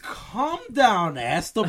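loud teasing. Acted. Teasing a friend's jailbreak plan.

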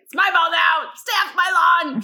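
loud, high cranky shout; Mister Nevercracker. Retold or imitated. Woman likening herself to a cartoon grouch, cranky shout. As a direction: impersonate a lawn-guarding grouch, shouting. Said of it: "I was Mister Nevercracker from Monster House".

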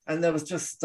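ordinary delivery

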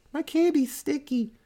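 put-on high, childlike complaint; little kid. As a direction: play a small kid complaining. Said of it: "one kid's like".

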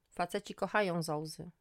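ordinary delivery, nothing marked

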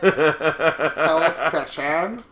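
very slow, laughing through it; drawn out giggly fix. Real, not acted. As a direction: correct yourself while cracking up. Correcting himself through laughter.

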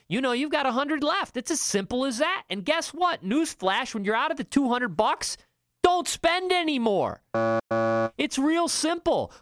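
slowing down hard on don't spend anymore; emphatic advice. Real, not acted. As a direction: drive it home, rising and insistent. Building emphatic budgeting advice.

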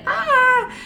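short high squeal of excitement; excited squeal. Real, not acted. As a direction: let out a giddy shriek. Excited about masterclass drop.

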